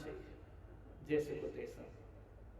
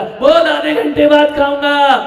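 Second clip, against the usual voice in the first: loud, high grumble; father shouting back; 7 semitones higher, 16 dB louder than usual. Retold or imitated. Annoyed father tells son he'll eat later, loud. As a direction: yell it through the wall.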